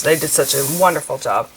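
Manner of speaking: ordinary talk, nothing marked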